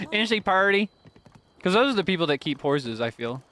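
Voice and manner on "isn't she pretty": put-on light southern accent. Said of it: "I pull out the southern accent".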